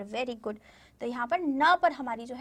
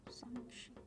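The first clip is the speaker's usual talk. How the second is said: whispered; 20 dB quieter, slower than usual. Shown as a demonstration. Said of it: "whispered repeat".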